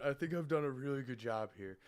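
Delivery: small, soft, meek imitation; mouse voice. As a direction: mimic a timid little mouse voice. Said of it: "this is talking like a mouse".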